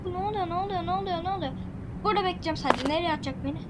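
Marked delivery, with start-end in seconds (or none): none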